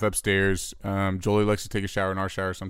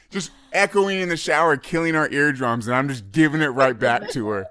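ordinary talk, nothing marked

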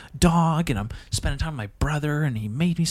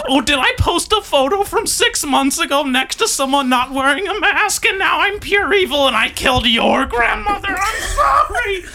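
much higher, shouted sarcastic rant; shrill hammed-up self-accusation; sarcastic mock outrage; 12 semitones higher than usual. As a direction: yell an over-the-top fake guilt.

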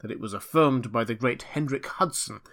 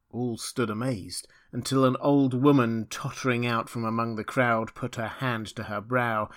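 usual speaking voice

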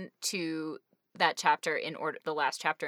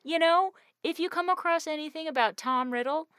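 high, loud incredulous complaint; mock exasperation; 11 semitones higher, 7 dB louder than usual. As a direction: push it up high as if stating the obvious. Mock-exasperated complaint about withheld information.

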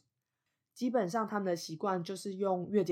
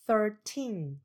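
put-on thirteen with a long, falling teen; stress on TEEN; 3 semitones higher than usual. Shown as a demonstration. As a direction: hit the second syllable of thirteen and stretch it. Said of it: "它的重音是在后面第二个音节". (thirteen 重音在后面的 teen，拉长)

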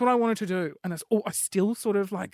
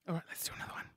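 quick whispered pep-up to self; 14 dB quieter, much faster than usual. Real, not acted. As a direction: psych yourself up under your breath. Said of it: "whispered to self".

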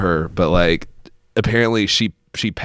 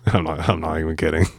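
laughing; 2 semitones lower than usual. Real, not acted. Laughing at dark family story.

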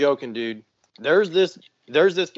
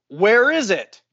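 raised, louder retold demand; getting mad; 5 semitones higher, 6 dB louder than usual. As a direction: imitate irritation starting to climb. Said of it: "I'm getting fucking mad and elevated".

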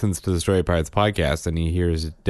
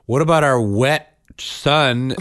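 higher, slow mock-indignant plea; indignant retold protest; 6 semitones higher, 4 dB louder, slower than usual. Husband replaying his complaint to his wife, mock-indignant. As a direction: stretch out the aggrieved question.

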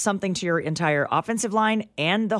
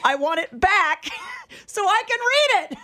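playful shout; 12 semitones higher than usual. Retold or imitated. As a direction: shout it back jokingly. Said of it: "I did say to him".

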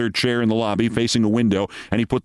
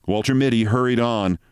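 ordinary delivery

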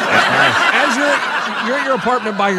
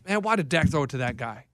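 mild annoyed question; 7 semitones lower, 7 dB quieter than usual. Retold or imitated. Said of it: "in that tone".

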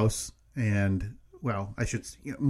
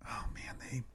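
unvoiced hushed aside; whisper; 3 semitones lower, 19 dB quieter than usual. Real, not acted. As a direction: breathe it under your voice.

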